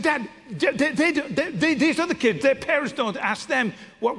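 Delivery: put-on high, stammering teen protest; exasperated teenage daughter. Daughter protesting that other parents don't ask, exasperated. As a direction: sputter it out like a frustrated teenager.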